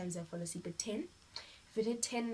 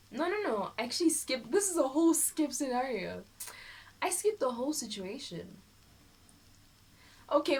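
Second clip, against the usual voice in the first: laughing; 6 semitones higher, 5 dB louder than usual. Real, not acted. Laughing at own confusion.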